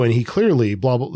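normal talking voice

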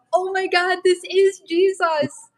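high, marvelling rush of wonder; awestruck. Retold or imitated. Recalling her thought as she sat by him, high and awed. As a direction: let it burst out in disbelief. Awed realization beside the man.

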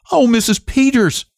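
calls out in shock to the sheriff's wife; startled cry. Acted. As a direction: cry out in horror at what she sees. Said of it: "she cried".

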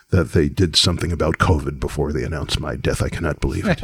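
condescending, low and measured disbelief; low, superior grumble of a put-on comic; Paul Mooney. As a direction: talk down in lofty disbelief. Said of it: "that was Mooney".

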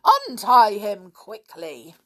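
loud, measured, slow order; angry amazement. A narrator's reading. Stepfather ordering the boys to untie the man, angry. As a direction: command it with some anger. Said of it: "in amazement and anger".

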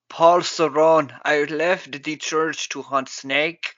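slow, terrible mock South American accent; awful put-on Paraguayan-style accent, drawn out; Jon Voight. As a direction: ham up a bad Uruguayan accent. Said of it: "his accent is terrible".

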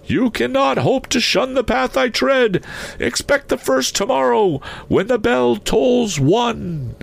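very high, fervent spectral voice; solemn warning ghost. A narrator's reading. Ghost come tonight to warn Scrooge, solemn high voice. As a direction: warn him high, as a spirit offering escape.

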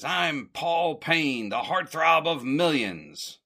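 high, slow, drawn-out grand declaration; self-important star. Retold or imitated. Film star introducing himself to noisy neighbors, self-important. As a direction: announce yourself with mock grandeur.

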